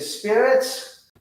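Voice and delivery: high, drawn-out summoning call; calling to spirits. Retold or imitated. Investigator addressing the spirits at the stairs, calling out.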